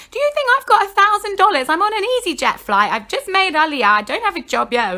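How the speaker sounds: high, indignant retold wail; hysterical protest. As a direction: protest as if near tears, starting loud and easing. Upset rant about the price.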